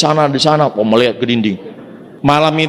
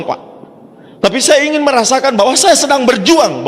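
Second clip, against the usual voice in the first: raised passion; 9 semitones higher than usual. Real, not acted. Passionate testimony builds up.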